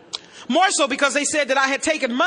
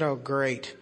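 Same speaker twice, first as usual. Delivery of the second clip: sarcastic; 12 semitones lower, 8 dB quieter than usual. Retold or imitated. Sarcastic reply to the records request.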